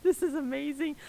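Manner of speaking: high-pitched, overwhelmed excitement; thrilled. Real, not acted. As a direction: gush in a very high voice at the cliffhanger. Game master thrilled at tense cliffhanger.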